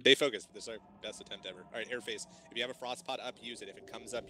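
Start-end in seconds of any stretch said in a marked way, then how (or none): 0.0-0.5 loud, urgent command; urgent shout. Real, not acted. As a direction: bark it out to the raid. Raid leader rallying mid-fight.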